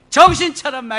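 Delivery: loud, high sudden rebuke; re-enacted shout to wake them up; scolding outburst. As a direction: jolt them with a sharp order. Scolds the cult members.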